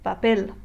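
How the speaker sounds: papel with clear stress on PEL; last syllable stressed. Shown as a demonstration. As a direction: land firmly on the end of papel. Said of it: "you stress the last syllable".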